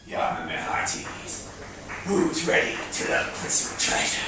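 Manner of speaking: slow, whispered menacing pirate; scary pirate voice. Acted. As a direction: whisper like a creepy pirate captain. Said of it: "Heave Ho".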